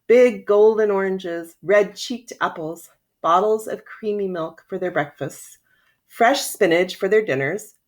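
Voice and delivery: plain reading voice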